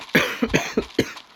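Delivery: short harsh chest cough; chesty cough. Real, not acted. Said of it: "Sorry, I'm coughing a lot".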